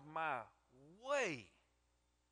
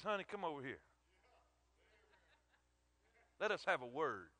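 gentle call; 2 semitones lower than usual. Acted. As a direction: draw her in with a kind word. Boaz calls Ruth over.

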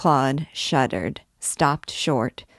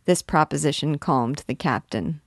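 ordinary delivery

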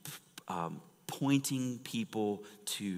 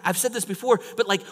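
ordinary talk

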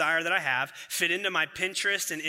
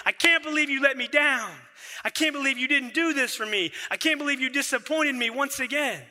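high, loud mock complaint at spouse; mock lashing out; 8 semitones higher, 4 dB louder than usual. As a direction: act out the frustrated spouse lashing out. Retold angry spouse lashing out.

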